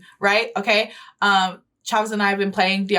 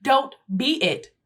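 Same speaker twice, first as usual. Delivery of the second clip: normal talking voice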